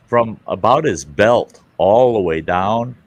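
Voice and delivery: ordinary talk, nothing marked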